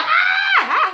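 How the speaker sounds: high, loud imitated shriek; mock high-pitched holler; imitated scream. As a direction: act out the girl screaming and hollering. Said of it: "Slave girl just screaming and hollering".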